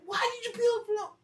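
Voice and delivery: hammed-up outraged uncle; angry uncle. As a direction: shout it as the furious uncle. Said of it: "He's like".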